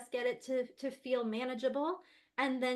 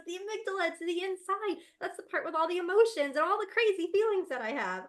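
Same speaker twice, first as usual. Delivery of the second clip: put-on high, eager child voice with a louder finish; son explaining; 8 semitones higher, 5 dB louder than usual. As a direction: play the proud little boy who knows. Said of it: "And he goes".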